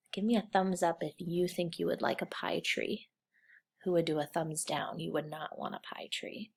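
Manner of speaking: ordinary delivery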